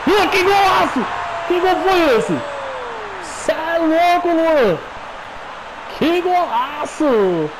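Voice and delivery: screaming goal celebration, very high; explosive goal commentary. Real, not acted. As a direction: erupt in a wild goal scream.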